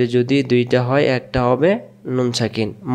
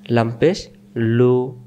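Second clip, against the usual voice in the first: usual speaking voice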